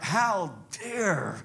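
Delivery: slow, rising cry of outrage; outraged preacher's challenge; righteous indignation. Acted. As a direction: draw out each phrase in holy indignation. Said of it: "It was a righteous indignation".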